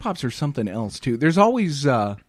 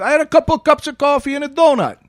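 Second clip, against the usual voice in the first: put-on higher, louder New York tough-guy accent; 12 semitones higher, 6 dB louder than usual. Boy answers teacher in a brash New York accent. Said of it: "say that in the accent too".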